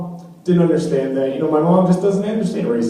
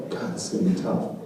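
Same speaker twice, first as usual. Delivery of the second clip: muttered aside; 7 semitones lower, 11 dB quieter than usual. Retold or imitated. Muttering to himself.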